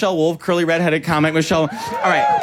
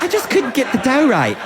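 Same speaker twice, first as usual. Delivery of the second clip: a light, higher British baker's sigh; lightly mock British, a touch higher; British contestant; 6 semitones higher than usual. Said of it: "there'd be episodes where she's like".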